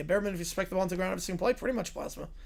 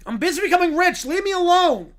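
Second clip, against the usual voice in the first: higher, louder brush-off; annoyed kid shout; 8 semitones higher, 8 dB louder than usual. Acted. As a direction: push mom away loudly. Kid shouting back at mom.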